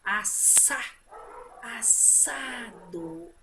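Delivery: "assado" slowly stretched with a strong hissed S; strong S sound. Shown as a demonstration. Teacher repeating the word for pupils, strong S in "assado". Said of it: "Som forte".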